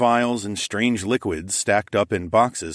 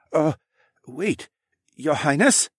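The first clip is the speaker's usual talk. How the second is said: higher, surprised shopkeeper; startled shopkeeper; 8 semitones higher, slower than usual. A narrator's reading. Shopkeeper recognising the princess, startled. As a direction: catch yourself, startled.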